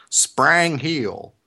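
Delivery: Tennessee drawl on Sprang Heel, vowels flattened to a and ee. Retold or imitated. Imitating the mayor's Tennessee accent for podcast friends. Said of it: "he pronounces Spring Hill like this".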